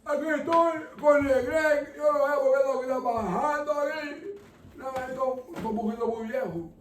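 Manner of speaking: high-pitched Spanish TV host voice; Don Francisco. Acted. Host presenting his guest on air, high put-on voice. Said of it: "Hola, Don Francisco".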